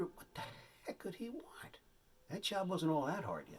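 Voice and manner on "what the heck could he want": hushed inner thought, whispered; a private whispered aside; whispered wonder. Retold or imitated. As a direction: whisper it to yourself, puzzled. Wondering to himself.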